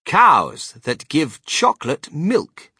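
slow, loud, measured announcement; reading out a sign. A narrator's reading. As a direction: proclaim the label word by word.

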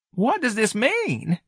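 very high, slow question; timid, puzzled manager. Acted. Office manager reading the banner, timid bewilderment. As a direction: ask it high and drawn out.

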